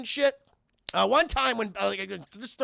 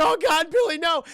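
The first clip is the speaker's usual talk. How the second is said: high, loud alarmed shout; mock panicked cry; 8 semitones higher, 6 dB louder than usual. Streamer as dad fearing for Billy, mock panic. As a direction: cry out in hammed-up dread.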